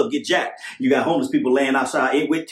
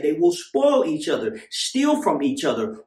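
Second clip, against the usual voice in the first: normal talking voice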